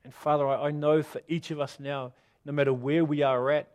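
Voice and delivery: soft, hushed pastor's prayer over music; gentle closing prayer. Acted. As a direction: pray quietly for the congregation.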